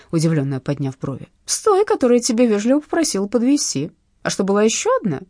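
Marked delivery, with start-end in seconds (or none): 1.5-5.2 sneering, quick taunt; mocking thug. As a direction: toss the question back with a sneer. (издевательский тон бандита)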